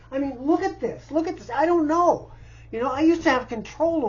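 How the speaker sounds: real distress pushing up in pitch; distressed outburst. Real, not acted. Pleading for help amid the mess.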